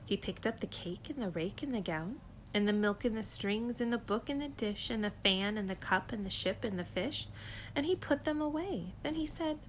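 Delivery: ordinary delivery, nothing marked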